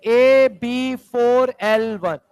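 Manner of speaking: formula drawn out very slowly; slow and deliberate. Shown as a demonstration. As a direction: stretch each part of the formula for the class. Repeats formula slowly for class.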